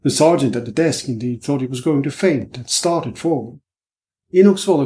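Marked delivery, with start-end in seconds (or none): none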